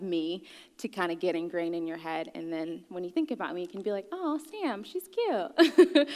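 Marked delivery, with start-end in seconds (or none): 4.1-6.1 high-pitched mock fawning; imitated cooing. Imagined audience member admiring her childhood photo, imitated cooing. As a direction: coo it high, like admiring a cute kid.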